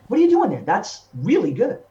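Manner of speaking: high, loud burst of astonished approval; surprised teacher. Retold or imitated. Teachers hearing the joke voice for a split second, surprised. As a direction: imitate a teacher suddenly impressed.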